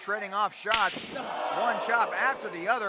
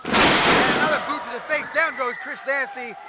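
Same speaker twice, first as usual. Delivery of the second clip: live play-by-play; 4 semitones higher, 7 dB louder than usual. Acted.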